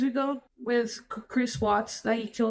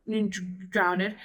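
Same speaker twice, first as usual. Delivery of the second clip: ordinary delivery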